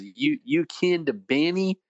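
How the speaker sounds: high, drawn-out Texas twang. Retold or imitated. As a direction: imitate a Texan professor's slow twang. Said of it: "in that Texas twang".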